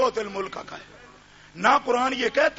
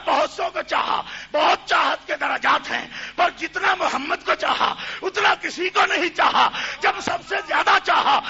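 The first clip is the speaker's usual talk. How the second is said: higher, louder sermon cry that drops off at the close; fiery preaching; 7 semitones higher, 4 dB louder than usual. Acted. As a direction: rouse the gathering, building to a peak. Preacher at full force.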